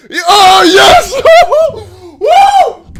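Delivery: loud, high cheer of relief; excited shouting. Real, not acted. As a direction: yell it out, over the moon. Caller finally gets back in.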